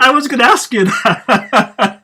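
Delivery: loud, high words through laughter; laughing hard. Real, not acted. Host reacting to guest's confession, laughing loudly. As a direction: crack up while talking, slow and loud.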